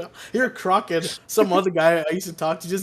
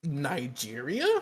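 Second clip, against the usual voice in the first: slow, softer questioning lilt; feigned innocence; 3 semitones lower, 8 dB quieter, slower than usual. Retold or imitated. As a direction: offer it slowly as an innocent question. Feigning innocence when caught swearing.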